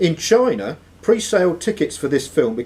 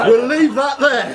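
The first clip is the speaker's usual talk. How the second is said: high, louder, laughing through the words; 11 semitones higher, 4 dB louder than usual. Real, not acted. Quizmaster moving on from a cheeky answer, still laughing. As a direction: try to move on but can't stop laughing.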